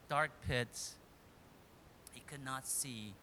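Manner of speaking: normal talking voice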